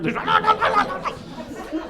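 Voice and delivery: high-pitched jowl-flapping babble; imitated wobbling gobble of an old woman; comic high wattle-shaking noise; wobbly turkey-neck noise. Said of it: "turkey neck thing's going everywhere".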